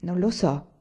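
understated, cool reply; cold. A narrator's reading. Margherita answering about her father's consent, coldly. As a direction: answer with restrained coldness. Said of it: "rispose con freddezza".

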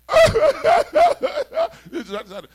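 high shrieking laugh, loud; high burst of laughter. Real, not acted. Laughing at the order to start laughing, high laugh.

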